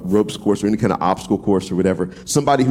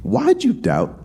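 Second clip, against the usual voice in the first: "why'd you doubt" lifted high in a light voice of Jesus; Jesus chiding Peter, "why'd you doubt" voiced high; gentle rebuke; 12 semitones higher, slower than usual. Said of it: "he said".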